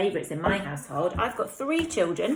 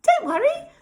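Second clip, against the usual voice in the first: very high little peep; squeaky friendly mouse; 9 dB louder than usual. Acted. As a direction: reassure the lion in a tiny voice. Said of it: "Mouse peeped".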